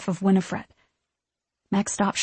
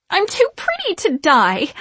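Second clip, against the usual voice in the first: high melodramatic wail; 10 semitones higher, 4 dB louder than usual. A narrator's reading. Sarah soaked and dripping, wailing. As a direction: lament it high and whiny. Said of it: "Sarah cried".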